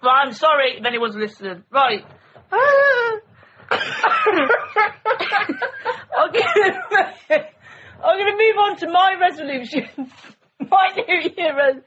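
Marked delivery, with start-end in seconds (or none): 2.5-11.8 laughing. Real, not acted. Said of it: "I'm literally crying".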